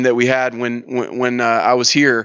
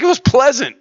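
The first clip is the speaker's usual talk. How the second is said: much higher, upbeat quote; pleasantly surprised retelling; 11 semitones higher, 3 dB louder than usual. Retold or imitated. Tailor reporting on jail, retold with pleased surprise. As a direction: imitate someone tickled by how nice it was.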